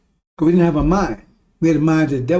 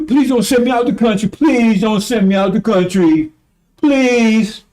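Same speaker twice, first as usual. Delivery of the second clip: higher, pleading demon voice, a little louder; demon begging; 4 semitones higher, 3 dB louder than usual. Retold or imitated. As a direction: beg like a cornered coward. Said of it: "he started begging him".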